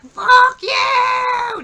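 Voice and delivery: very high, very loud drawn-out holler; hammed-up shriek of defiance; imitated angry yell. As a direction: scream the insult as if driving away. Retold parting insult, middle finger up.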